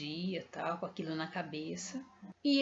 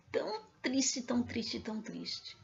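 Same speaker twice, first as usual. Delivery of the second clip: sad whisper fading; 3 semitones higher than usual. Real, not acted. Recalling Jesus's sad eyes, a fading whisper. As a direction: let it fade to nothing, sorrowful. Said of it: "whispered, fading repetition".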